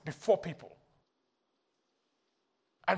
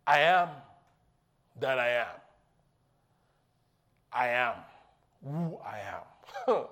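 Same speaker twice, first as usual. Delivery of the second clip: lower, slow, solemn God; God's voice; 9 semitones lower than usual. Acted. Preacher voicing God's reply, lower and solemn. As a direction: intone it like God.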